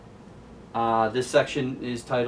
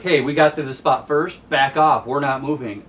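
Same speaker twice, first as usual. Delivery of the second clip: louder, territorial pushback; defiant shout; 3 semitones higher, 7 dB louder than usual. Retold or imitated. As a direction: stand your ground, loudly. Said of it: "So they're like".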